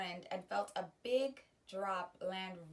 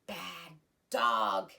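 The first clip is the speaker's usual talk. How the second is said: a louder half scold, held back; almost scolding; 4 semitones lower, 8 dB louder than usual. Acted. Felix nearly scolding the dog over the doll, half scold. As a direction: start to scold then hold it. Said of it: "he almost scolded".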